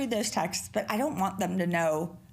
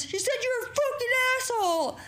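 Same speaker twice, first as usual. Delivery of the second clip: son tattling; 13 semitones higher than usual. Retold or imitated. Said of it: "And Mason goes".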